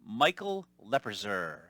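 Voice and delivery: slow, careful "Lebrazer"; mispronounced name. Retold or imitated. Telemarketer slowly mangling caller's name as "Lebrazer". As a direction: say "Michael Lebrazer" slowly and drag it out. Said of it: "She says".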